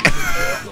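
squeaky burst of laughter; short high laugh. Real, not acted. Gamer laughing at his own Broly blunder.

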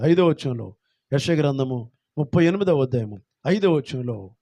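ordinary talk, nothing marked

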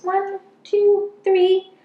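playful rising count; excited counting. Acted. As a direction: build up the count for the kids. Counting aloud for children.

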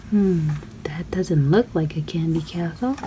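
hushed, soft whisper. Acted. Narrator whispers to the kids while searching for the castle. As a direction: whisper like sharing a secret.

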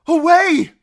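very high, loud shout; piercing yell of command; urgent cry. A narrator's reading. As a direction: cry the order to flee. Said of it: "she cried".

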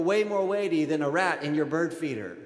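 ordinary talk, nothing marked

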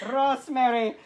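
high, loud mock-British introduction; attempted British accent. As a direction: announce the cat in over-the-top British. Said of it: "do it in a British accent".

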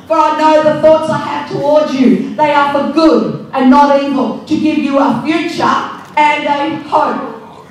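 loud, fervent scripture reading; preaching scripture. Acted. Preacher reading Jeremiah to the congregation, loud and fervent. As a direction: proclaim it to the whole church.

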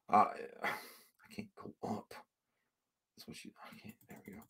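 barely audible mutter to himself; near-silent grumble; muttered under breath. Real, not acted. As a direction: murmur while fiddling with the mouse. Muttering while fixing stream.

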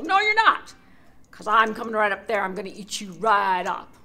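loud threatening troll voice; angry troll. Acted.

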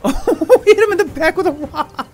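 high, loud laughing cry; laughing while cheering the pet; laughing shout. Real, not acted.

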